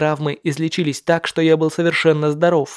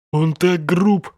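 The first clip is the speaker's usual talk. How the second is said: slow mocking gangster voice; slow sneering thug; much slower than usual. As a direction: sneer it out slowly. (медленный насмешливый бандит)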